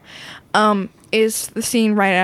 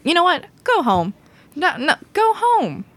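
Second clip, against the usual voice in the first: mock stern dismissal; 7 semitones higher than usual. Child voicing Washington dismissing Hamilton, mock stern. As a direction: send them packing, hammed up.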